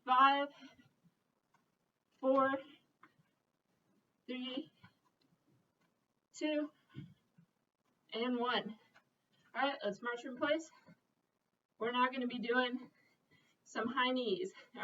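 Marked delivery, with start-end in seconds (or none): 0.0-8.8 aerobics instructor. Real, not acted. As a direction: shout the count to the class. Counting down the reps.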